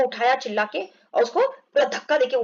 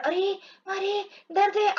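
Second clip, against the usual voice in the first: mock pain; 7 semitones higher, slower than usual. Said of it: "ऐसा बोल रहा".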